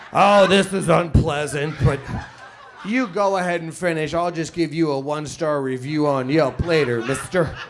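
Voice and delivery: mock indignant. Acted victim addressing stranger.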